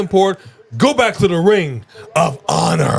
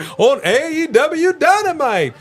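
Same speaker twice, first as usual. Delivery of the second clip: game show host; 12 semitones higher than usual. Retold or imitated. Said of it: "Or is it more like".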